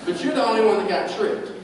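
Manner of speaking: high, loud, laughing preaching; loud laughing preacher voice; laughing through it. Real, not acted. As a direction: laugh while you tell it.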